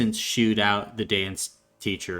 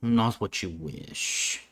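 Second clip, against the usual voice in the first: lightly voiced, solemn father; gruff father; 3 dB louder than usual. Father tells child of new family duty, gruff and solemn. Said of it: "you would hear a gruff voice go".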